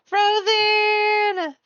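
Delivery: high, loud welcome cry; excited greeting. Real, not acted. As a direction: call the name out happily. Greeting a viewer by name.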